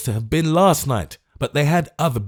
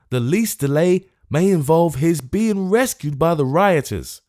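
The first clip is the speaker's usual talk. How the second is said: urgent, raised pressing appeal; speaking rapidly; 7 semitones higher than usual. Acted. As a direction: press the case in a hurry. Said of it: "and speaking rapidly".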